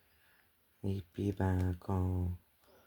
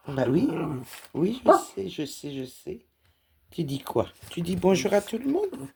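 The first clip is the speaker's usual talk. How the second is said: high-pitched cooing at the cat; high sing-song voice for a pet; pet talk; 7 semitones higher, 4 dB louder than usual. Real, not acted. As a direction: coo at your cat as she paws at you.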